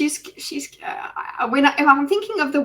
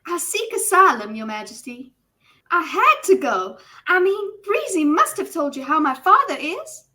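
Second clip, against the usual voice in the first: higher, bubbly Southern lady voice; runaway noblewoman pleading with the king in a Southern accent; hyped-up Southern belle; 5 semitones higher than usual. Acted. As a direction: gush in an over-the-top Southern accent. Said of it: "could you do Southern again, but, like, hyped up".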